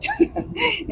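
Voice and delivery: real laugh, high and breathy at the end; a burst of real, high laughter. Real, not acted. Wife laughing at her own joke about taking everything, a high burst of laughter.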